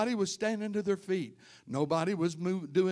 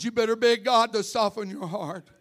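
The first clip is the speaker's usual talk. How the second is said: loud, higher repeat of the warning; loud, higher pulpit repeat; louder repeat; 5 semitones higher, 7 dB louder than usual. Shown as a demonstration. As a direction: hammer the warning home the second time. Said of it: "I said".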